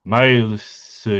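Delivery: normal talking voice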